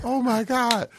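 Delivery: high, rising starstruck squeal of his name; imitating his own starstruck squeal. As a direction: gush like a fan meeting his idol. Starstruck meeting [name], retold.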